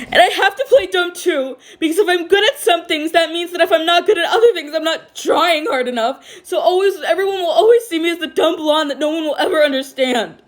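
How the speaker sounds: high, cracking laugh over despair; bitter broken laugh. A narrator's reading. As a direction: laugh it off while falling apart. Kaminari breaking down, laughing bitterly.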